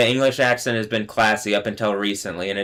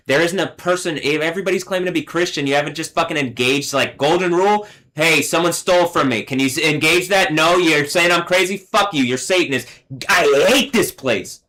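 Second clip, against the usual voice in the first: heated, higher-pitched real anger; angry rant; 5 semitones higher than usual. Real, not acted. Railing at false Christians who won't help, angry and worked up. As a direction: rant indignantly, pitch up, slowing hard on the final hate.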